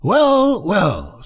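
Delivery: high, placating duck voice; peaceable duck. A narrator's reading. Duck avoiding a quarrel with the rocket, peaceable. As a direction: start very high and step down soothingly. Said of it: "of a very peaceable disposition".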